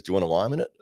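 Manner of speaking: "Do you want a lime in it" as one quick slurred word; unintelligible Australian question. Retold or imitated. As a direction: blur "want a lime in it", lift the end. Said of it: "I have no idea what this guy's saying".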